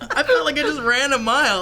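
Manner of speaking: laughing, high and loud; out of breath laughing. Real, not acted. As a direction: gasp it out between laughs. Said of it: "I'm sweating".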